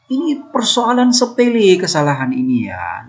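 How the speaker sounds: ordinary delivery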